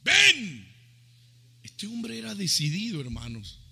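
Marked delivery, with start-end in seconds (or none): 0.0-0.7 the Lord's command, retold. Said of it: "El Señor le dijo".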